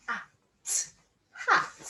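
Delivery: "Hat" 소리 나누어 또박또박; sounding out. Shown as a demonstration. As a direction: slowly split "Hat" into sounds. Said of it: "Let's sound out hat".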